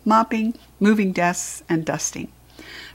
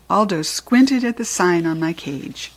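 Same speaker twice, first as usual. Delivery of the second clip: plain reading voice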